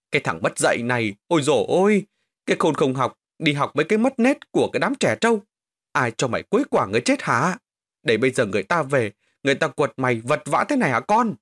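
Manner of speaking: a furious mother tearing into her son; scolding mother. Acted. Mother beating and scolding her son over the bet, shouting. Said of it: "mà chửi bới".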